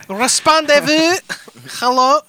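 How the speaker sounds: put-on high, loud French accent. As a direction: call out as a comic Frenchman. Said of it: "I think he's French".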